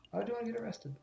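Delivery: high, hushed character voice; small high-pitched puzzled question. Acted. Game line voiced, then redone.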